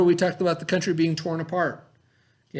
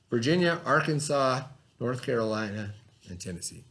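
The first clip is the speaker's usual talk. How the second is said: measured read-aloud to a class; 3 semitones lower, 3 dB quieter than usual. Acted. Teacher reading the Fort Sumter story to the class, read-aloud. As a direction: read the text clearly to young students. Reads history text to class.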